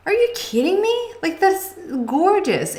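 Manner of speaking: amazed disbelief. Retold or imitated. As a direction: cry out in astonished delight. Amazed at the viewer's story.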